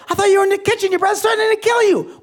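very high, loud mock-hysterical outburst; panicked wife. Wife warning husband about his brother's threat, panicked. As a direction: shriek it out like an alarmed wife.

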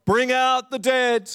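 high, slow town-crier call; high drawn-out street cry. Retold or imitated. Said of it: "ringing a bell and crying out".

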